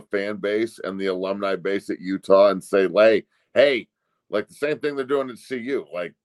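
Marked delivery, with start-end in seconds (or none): none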